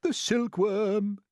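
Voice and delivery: slow, high, loud proclaiming; triumphant cry. A narrator's reading. Grasshopper crying out the solution, triumphant. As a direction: announce the answer with glee. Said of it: "cried the old green grasshopper".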